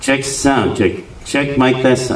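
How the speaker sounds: mic test through delay and repeat; echo. Shown as a demonstration. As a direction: sound check with echo effect. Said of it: "lagyan natin ulit ng effects".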